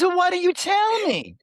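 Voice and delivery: voiced daughter. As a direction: play the pestering daughter up high.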